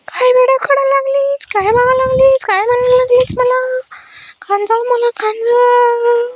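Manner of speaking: high, insistent pet voice; demanding pet. Pretend. Owner voicing her pet demanding to be fed, high insistent voice.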